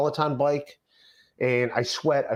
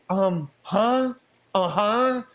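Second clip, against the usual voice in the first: confused woman; 7 semitones higher, much slower than usual. Retold or imitated. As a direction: play dumb in a higher, put-on voice. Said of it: "And she's like".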